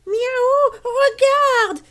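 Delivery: very high mewing cat voice; meowing cat. Acted. Yvan the cat spots something, very high meow. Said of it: "miaule-t-il".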